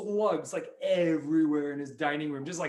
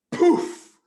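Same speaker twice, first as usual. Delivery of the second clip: high, loud "poof"; sudden high-pitched poof; magic poof; 13 semitones higher, 10 dB louder than usual. Pretend. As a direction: burst it out like a magic puff. Magic effect in story.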